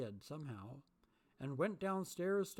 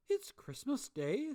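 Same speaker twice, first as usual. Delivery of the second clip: high, slow astonishment; can't believe the luck; delighted surprise; 11 semitones higher, 4 dB louder than usual. A narrator's reading. Scrooge to himself, delighted astonishment.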